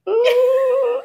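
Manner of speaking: high squeal of embarrassment; mock embarrassed ooh. Reacting to burping in front of nurse.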